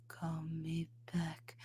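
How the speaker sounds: airy, breathy half-whisper, slow. Retold or imitated. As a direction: breathe the words out. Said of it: "breathy chest voice".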